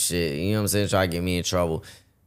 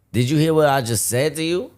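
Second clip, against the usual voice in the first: sharp, raised challenge; angry confrontation; 7 semitones higher, 4 dB louder than usual. Retold or imitated. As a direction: demand an answer, irritated. Customer confronting him angrily.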